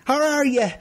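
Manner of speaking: high, badly faked New Zealand accent; attempted New Zealand accent. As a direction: try a New Zealand greeting that slips toward Scottish. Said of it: "New Zealand accent".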